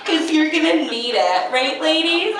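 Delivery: high, laughing recipe narration; mock cooking show host. Love recipe as cake, high and laughing. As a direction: read it like a sappy cooking host.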